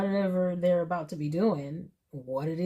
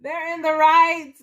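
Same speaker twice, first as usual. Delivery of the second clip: higher and louder, "They're in the right" punched out; emphatic excited repeat; 8 semitones higher, 8 dB louder than usual. Real, not acted. Reader to viewers, stressing the Emperor is right, excited and louder.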